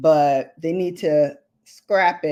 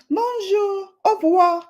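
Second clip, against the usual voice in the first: high-pitched, showy French accent; 13 semitones higher than usual. Shown as a demonstration. Vlogger proudly showing off her limited French, French accent. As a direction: show off French greetings in a high voice. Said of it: "Accent and everything".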